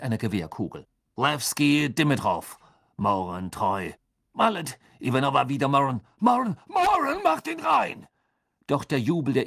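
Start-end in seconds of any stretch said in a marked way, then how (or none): 1.2-8.1 sports commentary. A narrator's reading. Commentator follows the passes to a goal, excited call. Match commentator calls the play, building.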